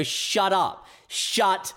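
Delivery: high, exasperated mock rant; fed-up retort. Fan snapping at a Smeagol pedant, high mock exasperation. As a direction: snap back at the pedant, overdone. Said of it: "And you'd go".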